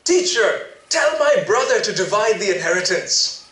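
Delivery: loud call over the crowd; shouting from the crowd. Acted. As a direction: call out to be heard. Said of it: "shouts out".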